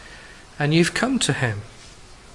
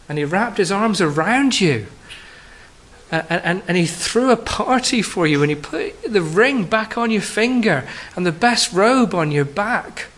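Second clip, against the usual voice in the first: louder, higher preaching that builds with joy; rising preaching fervour; 5 semitones higher, 3 dB louder than usual. Acted. Preacher describing the father running to his child, fervent. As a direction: preach the father's welcome with growing excitement.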